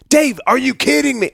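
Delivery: retelling his wife's disbelief, high and loud; mock incredulous wife. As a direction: squeal it like an appalled wife. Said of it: "Annie is from".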